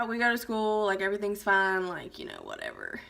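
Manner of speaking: plain reading voice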